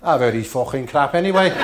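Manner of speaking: loud, high Scouser jab. Retold or imitated. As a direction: brush it off loudly like a cheeky Scouser. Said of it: "he said".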